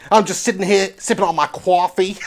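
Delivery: hammed-up New York tough guy; New York accent. Complaining about police over coffee, New York accent. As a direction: ham up the New York vowels. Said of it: "New York City".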